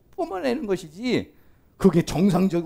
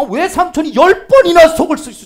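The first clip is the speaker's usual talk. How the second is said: high, loud burst of indignation; loud exasperation; 11 semitones higher, 14 dB louder than usual. Acted. Preacher exclaiming over the uncle's repeated cheating, loud exasperated outburst. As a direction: cry it out in disbelief, full volume.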